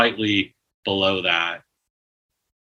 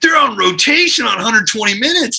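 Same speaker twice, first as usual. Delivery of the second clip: much higher, louder, giddy and thrilled; excited retelling; 13 semitones higher, 5 dB louder than usual. Retold or imitated. Recalling the band's hype about touring with [name], high giddy excitement. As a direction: gush like a starstruck young band. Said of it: "we're like".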